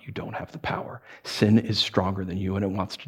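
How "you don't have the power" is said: near-whisper, much softer; whispered warning; hushed. Acted. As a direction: lean in and hush it.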